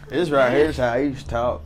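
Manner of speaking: South Carolina accent. Shown as a demonstration. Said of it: "I used to sound like this".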